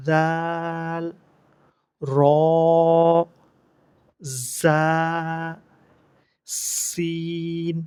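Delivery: each letter from আলিফ to দদ drawn out very slow and level; model pronunciation. Shown as a demonstration. Teacher repeats from alif for practice, slow sustained আলিফ through দদ. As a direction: hold every letter long, pausing between them. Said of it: "যেন এইভাবে উচ্চারণ করতে পারেন".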